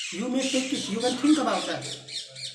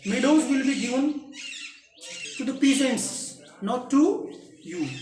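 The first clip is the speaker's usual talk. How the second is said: firm angry father; 5 semitones higher, 5 dB louder, slower than usual. Acted. Father's angry retort continues.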